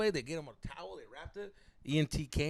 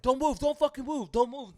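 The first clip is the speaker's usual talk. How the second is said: loud, high-pitched alarm; imitated urgent warning; 10 semitones higher, 5 dB louder than usual. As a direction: call out frantically to stop someone moving. Onlookers warn the injured man.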